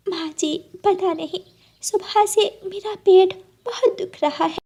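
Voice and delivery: put-on high strained girlish voice; bride in pain. Bride telling mother-in-law her stomach hurts, high and strained. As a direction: complain of stomach ache.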